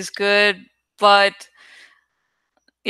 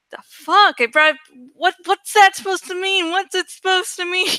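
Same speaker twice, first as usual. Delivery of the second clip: confused random fan; 9 semitones higher than usual. Retold or imitated. Random viewer baffled by the fan art, high indignant squawk. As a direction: play a bewildered stranger squawking at the picture. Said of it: "it'd be like".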